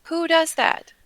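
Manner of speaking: high-pitched incredulous questions; appalled disbelief. Acted. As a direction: ask it as if utterly appalled. Acted disgust at carrying a head.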